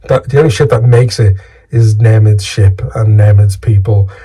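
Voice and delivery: normal talking voice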